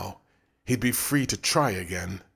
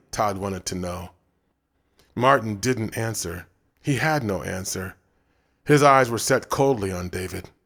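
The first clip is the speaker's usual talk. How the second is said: ordinary delivery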